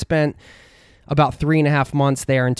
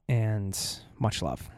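quiet, choked-up sign-off, much softer and lower; emotional; 3 semitones lower, 9 dB quieter, slower than usual. Real, not acted. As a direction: let the feeling catch your voice as you say goodbye. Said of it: "I'm getting a little emotional there".

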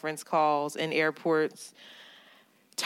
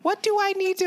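mock high whiny question; 13 semitones higher, faster than usual. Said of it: "We're like".

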